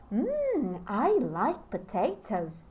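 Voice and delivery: slow musing hum, then a settling fall; thoughtful agreement. Shown as a demonstration. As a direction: weigh it, then accept it. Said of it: "I'm acting out".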